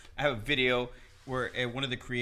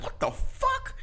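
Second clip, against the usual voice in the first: stunned disbelief; slower than usual. Retold or imitated. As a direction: pitch shoots way up, baffled. Disbelief at the stranger.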